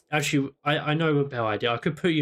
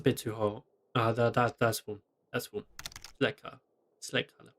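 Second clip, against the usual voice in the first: muttering to self; 5 semitones lower, 6 dB quieter, faster than usual. Real, not acted. Muttering while choosing settings.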